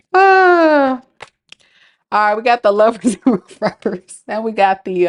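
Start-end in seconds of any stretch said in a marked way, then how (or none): 0.1-1.0 laughing outburst. Real, not acted. Cracking up at her own joke.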